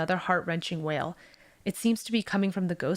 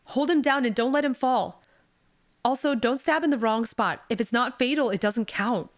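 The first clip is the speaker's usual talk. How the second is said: louder, higher orders; commanding; 5 semitones higher, 4 dB louder than usual. A narrator's reading. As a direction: bark orders at the mob. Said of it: "A man says".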